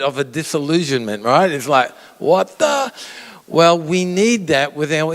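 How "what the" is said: high, squeaky shocked "what the?"; stunned surprise. Retold or imitated. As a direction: yelp it like you've just been fooled. Disillusioned at the puppet reveal.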